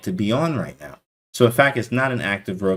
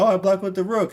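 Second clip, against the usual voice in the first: mocking high voice; 10 semitones higher, faster than usual. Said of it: "And you're like".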